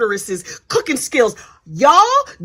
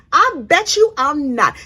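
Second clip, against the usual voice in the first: ordinary talk, nothing marked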